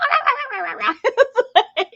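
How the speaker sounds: high burst of laughter; laughing. Real, not acted. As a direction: break up laughing. Laughing at the memory.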